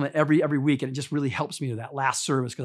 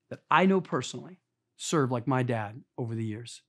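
ordinary delivery

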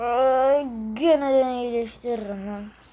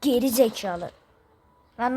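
usual speaking voice

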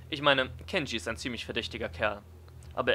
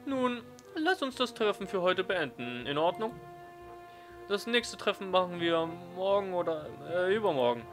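teacher voice; 8 semitones higher than usual. Acted. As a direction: play the teacher, measured and kind.

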